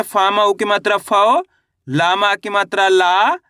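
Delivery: slow spelling drill, each new syllable held and lifted; syllable-by-syllable demo. Shown as a demonstration. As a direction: stretch "फौ" and "ला" with rising ends. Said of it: "तो कैसे पढ़ेंगे?".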